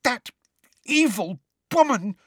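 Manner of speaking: high, halting outrage; gasping with rage. A narrator's reading. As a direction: choke the words out, too furious to talk. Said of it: "gasped Hermione, who seemed to be having difficulty talking due to rage".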